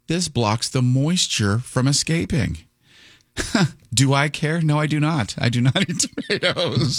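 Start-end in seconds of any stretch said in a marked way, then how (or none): 2.6-7.0 laughing. Real, not acted. Laughs at own dislike.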